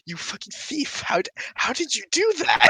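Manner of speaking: high-pitched, incredulous accusation; mock accusation. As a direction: imitate an outraged, baffled friend. Barbarian's accusation acted out.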